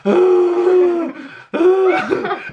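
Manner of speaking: very high, loud wailing "ah"; imitated pain scream. Partner mimicking her labor cries, hammed-up scream. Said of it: "It hurts to scream".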